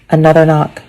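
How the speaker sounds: ordinary delivery, nothing marked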